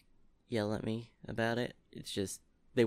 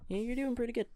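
mock encouraging; 14 semitones higher, much faster than usual. High encouraging voice.

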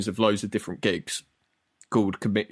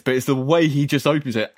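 normal talking voice

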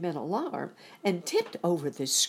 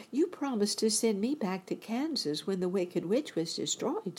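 higher-pitched Dorothy, pleading her case; young girl's voice; 6 semitones higher than usual. A narrator's reading. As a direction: voice a girl reminding of a promise.

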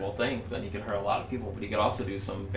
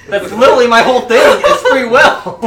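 loud laughing protest; laughing shout; 14 semitones higher, 19 dB louder than usual. Real, not acted. Laughing outburst in character.

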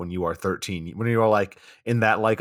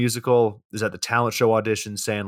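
ordinary talk

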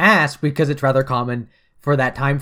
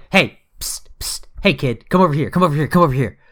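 psst for attention. Retold or imitated. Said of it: "it's sort of like".

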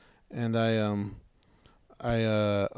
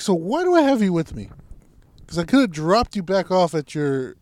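high, raised exasperated voice; retold yelling; 6 semitones higher, 4 dB louder than usual. Storyteller scolding the useless helper, exasperated. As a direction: act out telling him off. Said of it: "the one I just yelled at".

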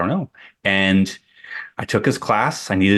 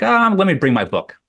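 eager; 10 semitones higher than usual. Retold or imitated. Eager student offering his book.

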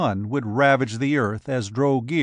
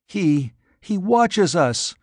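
higher, slow, faltering fright; whimpering; 6 semitones higher, slower than usual. Acted. Frightened girl after the light flares, high slow whimper. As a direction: stammer it out in mild fear, higher. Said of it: "the girl whimpered".

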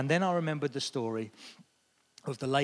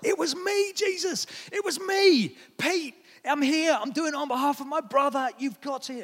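reach out to Jesus in desperation; desperate plea; 11 semitones higher, 3 dB louder than usual. Retold or imitated. Preacher retells crying out to Jesus for his brother, high pleading. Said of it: "And I was like".

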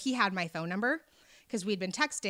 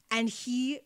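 normal talking voice

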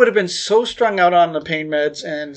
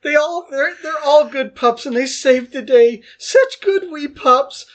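put-on much higher, childlike excited squeal, slowing at the end; gushing cartoon fan; 7 semitones higher than usual. Groggy on pain meds, gushing to Nick about Paw Patrol, high giddy squeal. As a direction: cheer it like a giddy kid watching cartoons. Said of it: "then I was like".

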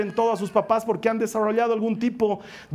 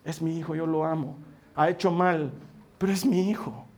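retold tearful mother; 5 semitones lower, 5 dB quieter than usual. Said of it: "entre lágrimas decía".

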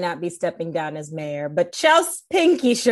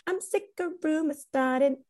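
Lindsay Lohan; 6 semitones higher, 3 dB quieter than usual. Retold or imitated. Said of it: "It was giving me Lindsay Lohan".

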